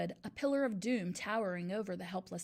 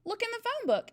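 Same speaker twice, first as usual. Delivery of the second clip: put-on much higher small-boy voice; corrected, Eric's voice; 13 semitones higher, 6 dB louder than usual. A narrator's reading. Eric answers the headmistress in a high small-boy voice. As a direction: pipe it up like a little boy. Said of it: "Wrong person".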